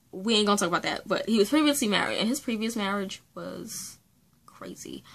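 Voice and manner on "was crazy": hushed aside. Acted. Crazy" dropped to near silence.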